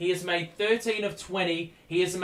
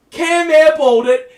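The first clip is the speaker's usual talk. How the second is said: sudden loud, high cry of disbelief; real jolt of excitement; excited outburst; 9 semitones higher, 12 dB louder than usual. Real, not acted. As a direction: shout it out as the shot misses. Live reaction to game play.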